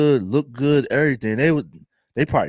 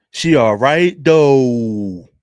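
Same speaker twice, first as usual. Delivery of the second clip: loud emphatic; 8 dB louder, much slower than usual. Real, not acted.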